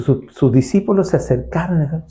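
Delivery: ordinary delivery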